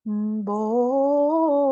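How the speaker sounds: sing-hum "mm, boat" like a warm-up; vocal exercise boat. Shown as a demonstration. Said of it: "like, a vocal exercise".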